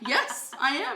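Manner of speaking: high, giggly yes I am; laughing reply. Real, not acted. Answering her employee's check-in, laughing. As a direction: laugh through the words.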